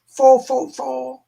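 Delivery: high, playful British-accent imitation. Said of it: "I have a British friend, and she always says".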